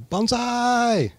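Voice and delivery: high, loud drawn-out war cry; battle yell. Acted. Bonsai teacher demonstrating the cry before battle, high loud yell. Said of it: "you yell".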